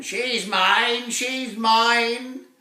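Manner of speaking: high, loud jeering shout of a talking rock; shouted taunt. A narrator's reading. Magic boulder shouts at the dragon, loud gloating cry. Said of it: "it shouted at the dragon".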